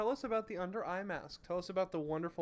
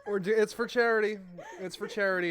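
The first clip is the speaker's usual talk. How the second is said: laughing; 5 semitones higher, 7 dB louder than usual. Real, not acted. Laughing through gross mask.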